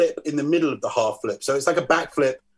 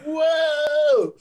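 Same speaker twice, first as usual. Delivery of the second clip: excited crowd cheer; 14 semitones higher than usual. Retold or imitated. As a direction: whoop it up like thrilled friends. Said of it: "They were like".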